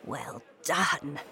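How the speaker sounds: low, hushed grandmother voice; whispering back. A narrator's reading. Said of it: "she whispered back".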